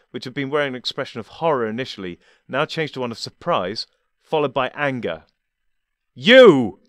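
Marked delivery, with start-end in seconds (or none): 6.1-6.8 sudden high, loud outraged cry; loud indignant shout of recognition. Acted. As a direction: blurt it out in furious shock. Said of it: "he cried indignantly".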